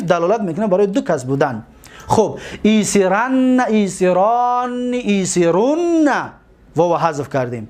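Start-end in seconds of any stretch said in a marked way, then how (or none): 2.6-6.1 each form stretched slowly with held final n; slow drill. Shown as a demonstration. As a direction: dictate the forms slowly, one by one. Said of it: "teacher drills conjugation forms".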